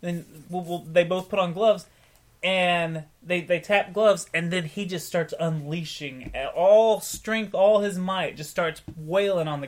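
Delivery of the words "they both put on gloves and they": ordinary delivery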